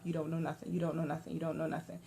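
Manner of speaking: soft, low repeated mutter; muttering under breath. Retold or imitated. Friend on the curb coaching her, muttering under his breath. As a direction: mumble it under your breath, over and over. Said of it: "muttering on his breath".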